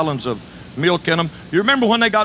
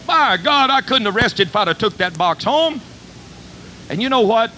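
preaching; 4 semitones higher than usual. Acted. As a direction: preach the moral with fervor. Preaching the lesson of the story.

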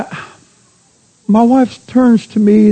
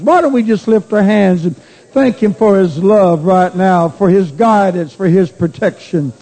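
leading worship prayer. Acted. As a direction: lift the room into thanksgiving. Leads the congregation in prayer.

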